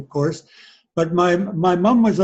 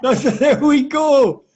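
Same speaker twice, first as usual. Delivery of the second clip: laughing delight; 7 semitones higher, 3 dB louder, slower than usual. Real, not acted. Dad hears his ethnicity result.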